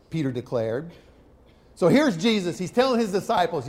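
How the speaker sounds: ordinary talk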